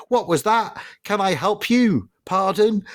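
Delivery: high, prim voice-assistant tone; fussy car assistant. Retold or imitated. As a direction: play the nosy talking car butting in. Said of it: "the car was like".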